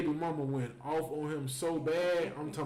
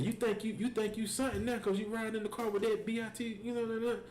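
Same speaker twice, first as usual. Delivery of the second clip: higher-pitched imitation of an angry tirade; going off on him; 7 semitones higher than usual. Baby mama unloading on her children's father, imitated angry rant. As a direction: retell her indignant telling-off. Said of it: "she unloaded on his ass".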